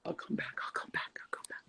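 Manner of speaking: soft whispered goodbye; hushed. Real, not acted. Mother whispering to viewers as she leaves, hushed. Sneaking off with child awake.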